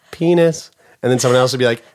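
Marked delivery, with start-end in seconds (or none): 0.1-0.7 louder penis. Retold or imitated.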